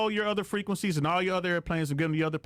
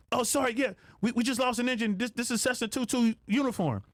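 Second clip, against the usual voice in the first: higher, offhand pilot apologizing mid-emergency; higher, casual pilot voice reporting in; pilot on the radio; 7 semitones higher than usual. Retold or imitated. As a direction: sound like a pilot who just lost an engine but is trying to stay casual.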